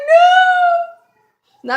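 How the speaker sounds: very high, loud, drawn-out wail; horrified scream. Retold or imitated. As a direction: reenact a shrieked refusal.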